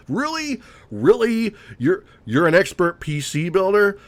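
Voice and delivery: high, disbelieving questions; mock incredulity. Sneers at self-proclaimed PC expert.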